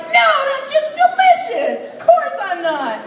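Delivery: high, laughing indignant reply; mock indignant. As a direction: squeak it out, offended and amused. Shopper answering a silly sign.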